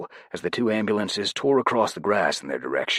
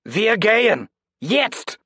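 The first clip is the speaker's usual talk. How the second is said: very high, loud urgent German order; German command; 6 dB louder, much slower than usual. A narrator's reading.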